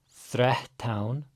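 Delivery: threhtán with a breathy h before the t; pre-aspiration. Shown as a demonstration. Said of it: "our double T's. Gotta pre-aspirate".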